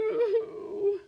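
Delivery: high fake boo-hoo cry; hammed-up wail. Bear still blubbering, loud mock wail. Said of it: "the bear blubbers on".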